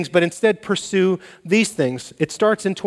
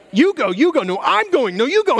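mock polite drivers; 9 semitones higher than usual. Mock drivers at four-way stop.

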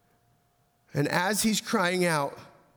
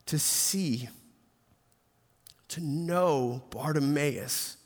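reflective hush. Acted. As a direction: let each phrase land gently. Slow reflective sermon close.

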